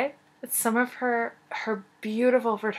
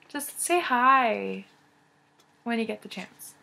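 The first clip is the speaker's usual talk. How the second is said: slow pleading; slower than usual. Real, not acted. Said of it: "sometimes I'm like".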